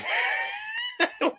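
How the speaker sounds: a shrieking burst of laughter. Real, not acted. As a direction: howl with laughter at the blunder. Laughing at Stuntman's mistake.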